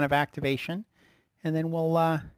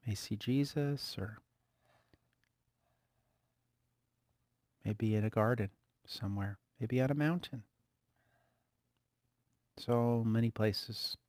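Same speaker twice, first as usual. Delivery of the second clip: guided meditation; 7 dB quieter than usual. Acted. Leads a soft guided meditation.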